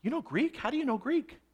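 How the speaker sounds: puzzled double take, ending on a rising question; taken aback. Acted. Tribune to Paul who speaks perfect Greek, taken aback. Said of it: "it takes him back".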